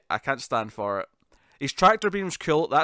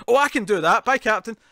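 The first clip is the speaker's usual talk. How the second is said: loud, higher, cheeky mock brush-off; retold eager reply; 7 semitones higher, 7 dB louder than usual. Wesley signing off on the captain, mock eager. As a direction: mimic someone gleefully cutting the captain off.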